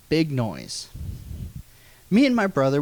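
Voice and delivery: normal talking voice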